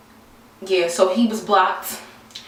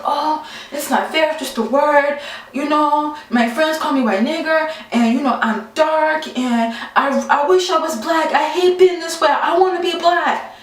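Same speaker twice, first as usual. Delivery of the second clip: light mock Italian accent, higher and a bit whiny; mock Italian accent, higher and pleading; imitating Italian accent; 6 semitones higher than usual. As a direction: play the guy whining in a hint of an Italian accent. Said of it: "he has an, an Italian accent".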